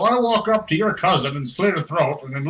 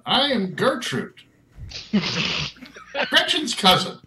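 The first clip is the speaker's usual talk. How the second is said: high put-on character voice; slow, high self-introduction; Gertrude; 7 semitones higher than usual. Game master introduces Gertrude in character.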